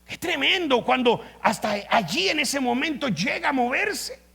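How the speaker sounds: loud, high, driving sermon voice; fiery preaching. Acted. Pastor thrilling the church with Elisha's miracle, fiery preaching. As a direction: build the miracle story with fire.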